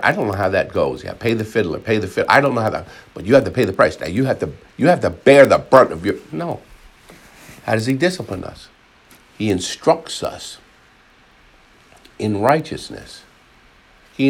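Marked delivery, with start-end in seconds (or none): none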